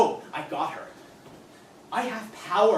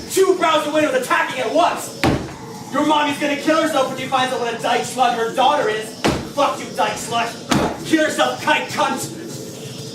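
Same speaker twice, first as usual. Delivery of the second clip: loud and higher, spitting each slur; frenzied hate; 5 semitones higher, 7 dB louder than usual. Acted. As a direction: lose control in the attack. Frenzied harassment.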